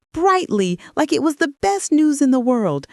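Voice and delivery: high, sunny announcing tone; bright cheer. A narrator's reading. Daughter-in-law announces her hosting plans, brightly cheerful. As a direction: lift it high, like great news.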